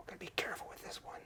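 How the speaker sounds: fast hushed whisper; quick careful whisper. Real, not acted. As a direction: whisper it like it might break. Handling a fragile signed item.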